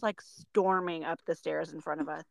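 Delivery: ordinary delivery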